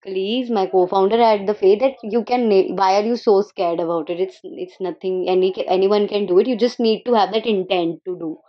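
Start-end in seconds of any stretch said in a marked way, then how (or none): none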